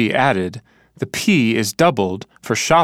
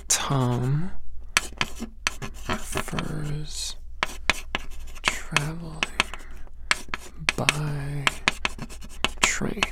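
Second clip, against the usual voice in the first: slow, much softer word-by-word dictation; writing aloud; 4 semitones higher, 11 dB quieter, much slower than usual. Shown as a demonstration. [name] writes Marta's sentence, dictating quietly to himself. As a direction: spell it out under his breath as he writes. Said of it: "Can you write it for me?".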